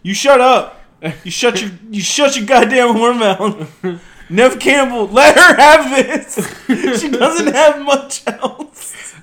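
loud, laughing mock fury; play-yell at your friend, cracking up; mock outrage. Defending Neve Campbell from friend, loud laughing mock fury.

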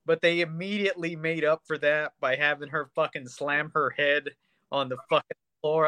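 ordinary talk

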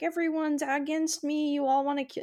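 high-pitched imitation of paranoid panic; mock freak-out. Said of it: "freak out where he's like".

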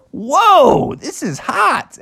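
high, drawn-out shock at the heat; startled Bubby. Acted. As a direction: yelp it as a cartoon bear. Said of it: "he was like".

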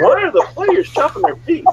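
high-pitched retold bewilderment; baffled disbelief. Player questioning drills while coaches fight, baffled disbelief. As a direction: question it in high, incredulous protest.

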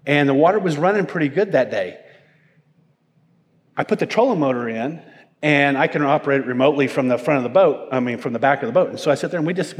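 ordinary talk

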